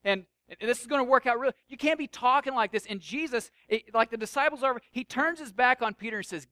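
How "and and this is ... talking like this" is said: higher, louder, rushing objection; flustered protest. Acted. Peter pleading his ambitions to Jesus, flustered and insistent. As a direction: break in urgently and talk him out of it. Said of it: "So Peter's like".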